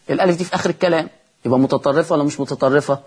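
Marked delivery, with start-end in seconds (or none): none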